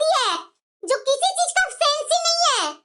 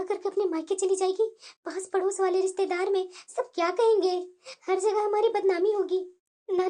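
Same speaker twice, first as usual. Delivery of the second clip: worried mother-in-law; 8 semitones lower, 5 dB quieter than usual. Acted.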